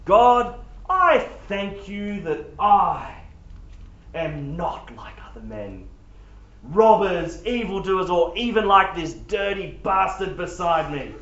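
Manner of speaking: self-satisfied praying voice, stretched out and showy; a pompous Pharisee's boastful prayer, with big loud bursts; smug Pharisee. Acted. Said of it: "let me read it in a different voice".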